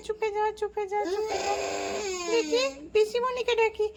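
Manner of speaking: put-on a frightened young girl trying to hush a crying child; upset child voice. Babli calling aunt for help, high tearful child voice. Babli panics as Piya cries.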